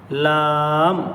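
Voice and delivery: normal talking voice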